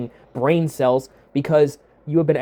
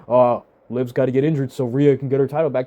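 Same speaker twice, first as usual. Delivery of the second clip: lower, mock dopey fan voice; 5 semitones lower than usual. Host mocks fans wanting Liv hurt, lower sneering voice. Mocking fans wishing injury.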